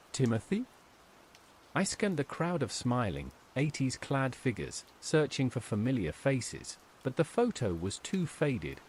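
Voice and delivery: usual speaking voice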